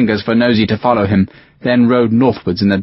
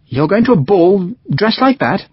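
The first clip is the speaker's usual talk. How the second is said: higher pitched, disbelieving "like that"; can't believe he'd go "like that"; incredulous; 8 semitones higher than usual. Acted. Young lieutenant to bloodied Sharpe, disbelieving.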